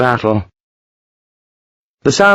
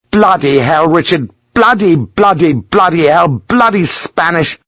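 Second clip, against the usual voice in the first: furious swearing; 7 semitones higher than usual. Acted. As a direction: spit out the swearing in a rage. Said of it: "he was furious".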